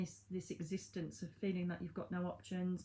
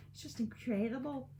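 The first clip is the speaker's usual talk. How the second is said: put-on Scottish accent; 4 semitones higher than usual. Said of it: "doing a Scottish accent".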